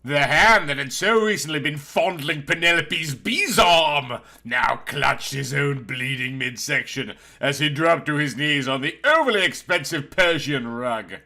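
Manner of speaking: usual speaking voice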